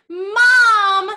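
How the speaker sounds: "Mom." called out loud and high; loud "Mom" demo. Shown as a demonstration. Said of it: "say for me".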